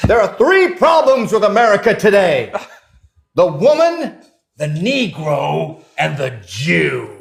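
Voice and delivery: громкий, потом очень медленный нарочито зловещий тон; изобразить карикатурного расиста, растягивая последние слова; mock bigot speech. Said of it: "I start out and I said".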